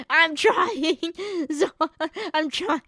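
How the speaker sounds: fighting off tears with a laugh; laughing through tears. Real, not acted. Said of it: "the tears keep fighting back".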